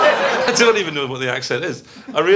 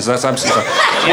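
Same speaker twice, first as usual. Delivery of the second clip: usual speaking voice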